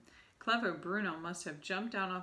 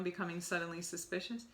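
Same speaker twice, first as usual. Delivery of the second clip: ordinary delivery, nothing marked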